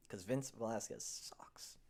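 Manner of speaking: slow, hushed whisper; whispered put-down; whispered aside. Real, not acted. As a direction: slip it in like a secret dig. Aside jab at pitcher.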